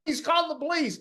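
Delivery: very high, strained cry to call the police; shrill fake panic, retold; mock scream for help. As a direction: ham up the bully playing victim. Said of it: "he's the one screaming".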